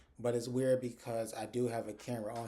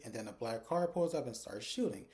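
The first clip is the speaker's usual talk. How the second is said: ordinary delivery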